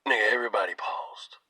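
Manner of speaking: hushed whisper. Real, not acted. Telling viewers how the church froze, hushed whisper. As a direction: whisper it, the room gone silent. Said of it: "whispered aside".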